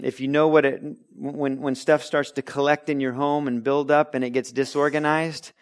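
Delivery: normal talking voice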